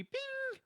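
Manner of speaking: high sung ding like a microwave; microwave ding sound. Retold or imitated. Imitating the microwave ding for his friend. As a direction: chime like the microwave finishing.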